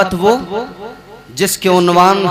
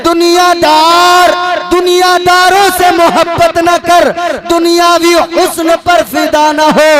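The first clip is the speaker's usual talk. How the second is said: preaching at full force; 7 semitones higher than usual. Real, not acted. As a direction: urge the crowd with fervour. Preacher exhorts crowd.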